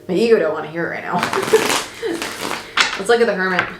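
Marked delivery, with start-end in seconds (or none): 1.2-2.9 a sudden high airy giggle; high breathy laugh. Real, not acted. Reader laughing at her own stubborn ego, high giggle.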